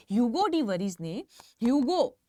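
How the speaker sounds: normal talking voice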